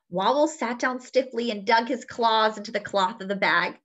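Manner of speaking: ordinary delivery, nothing marked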